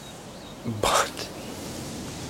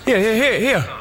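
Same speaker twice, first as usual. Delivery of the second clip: raised voice calling for attention; 11 semitones higher, 3 dB louder than usual. Acted. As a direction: call out to get noticed. Calling out to someone on the street.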